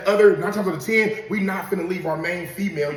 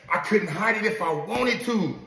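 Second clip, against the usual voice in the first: usual speaking voice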